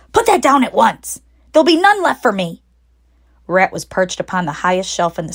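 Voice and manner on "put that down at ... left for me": higher, fast, indignant squeal; a greedy rat scolding; shrieking rat. Acted. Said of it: "shrieked Rat".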